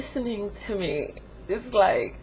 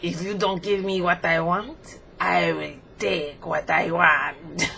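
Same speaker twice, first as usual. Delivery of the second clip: put-on low, slow French-accented threat; Frenchie; 5 semitones lower, slower than usual. Frenchie threatening to take over, French accent. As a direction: menace in a mock French accent. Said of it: "like, a little French accent".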